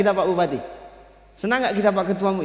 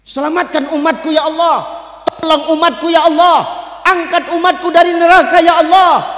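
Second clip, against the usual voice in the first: high, loud, desperate prayer cry; pleading shout to God; 9 semitones higher, 7 dB louder than usual. Acted. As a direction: beg God at full voice for his people. (seruan doa memohon dengan keras)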